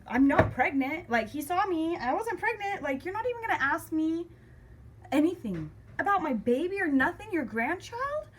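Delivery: high, loud disbelief; indignant outburst. Retold or imitated. Daughter fuming at dad who ignores her baby, indignant. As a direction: retell outraged thoughts, pitch climbing high.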